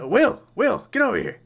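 general calling Will over, pitched well up. Acted. General summons his soldier Will, calling loudly. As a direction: summon a soldier across the field.

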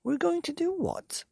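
very high, astonished little ones; high-pitched shocked question; incredulous cry. A narrator's reading. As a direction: squeak it in disbelief. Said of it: "they cried".

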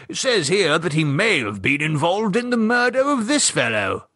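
lightly voiced chatty old gent; a hint of the gossipy old husband; Gerald's voice. Gerald pointing out the article to Michelle, lightly voiced old gent.

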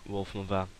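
usual speaking voice

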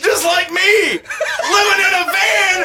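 manic high shouting with laughter; Chris Farley. Pretend. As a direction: yell it like a wild, manic comic. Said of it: "Chris, Chris Farley".

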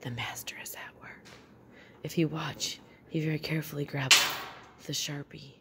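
hushed golf announcer; golf commentator whisper. Pretend. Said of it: "I was trying to do a golf thing".

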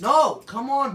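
loud, high plea to the tops; exasperated protest. Real, not acted. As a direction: cry out in protest. Scolding the misbehaving tops.

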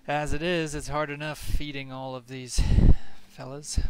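mock-weary NPC complaint; fond exasperation. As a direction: grumble fondly about the hungry flock. NPC jokes about feeding griffins.

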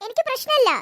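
put-on high, syrupy innocent-girl voice; fake sweetness.

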